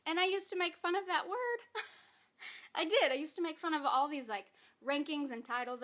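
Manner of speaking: real crying through a half laugh; tearful laugh that keeps cracking; crying while talking. Real, not acted. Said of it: "Hold it together, [name]".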